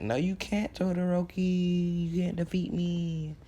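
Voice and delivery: high, drawn-out mocking taunt. Izuku to Todoroki who thinks he can win, taunting. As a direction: stretch it out up high, teasing a rival.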